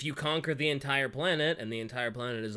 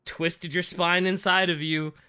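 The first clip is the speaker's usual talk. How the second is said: mock sheepish apology; 6 semitones higher, 10 dB louder than usual. Mock apology voicing Perturabo.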